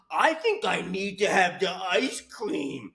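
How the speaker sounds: slow, put-on whiny craving voice; hammed-up pathetic food craving; mocking dieter voice. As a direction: drag it out in a mocking imitation. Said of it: "I'm just saying it in a tone that really reflects what the fuck I hear".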